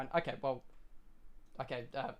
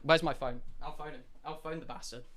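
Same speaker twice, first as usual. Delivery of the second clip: ordinary talk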